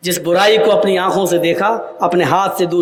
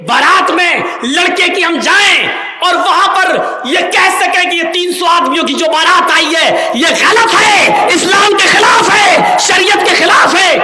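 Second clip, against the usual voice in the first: rising to a full preaching shout, much higher and louder; impassioned shout; 9 semitones higher, 5 dB louder than usual. Real, not acted. Preacher shouting at the wedding crowd, impassioned and loud. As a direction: thunder it at the congregation.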